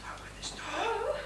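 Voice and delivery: urgent whisper at the door. Acted. Father whispering to daughter through bathroom door, whisper. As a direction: hiss it through the keyhole.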